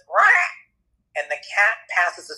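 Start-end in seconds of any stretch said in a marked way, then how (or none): 0.1-0.5 short, high, loud mock yowl; sudden cat screech. Cat passing a stone, sudden high loud screech. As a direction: let out a startled cat squawk. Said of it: "all of a sudden you hear this".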